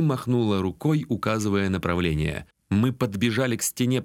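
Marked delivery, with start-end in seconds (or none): none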